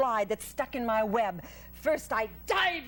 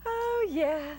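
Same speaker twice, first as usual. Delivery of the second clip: sweet musical voice; 6 semitones higher than usual. A narrator's reading. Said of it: "in her sweet musical voice".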